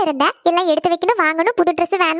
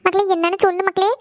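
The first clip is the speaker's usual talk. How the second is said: ordinary delivery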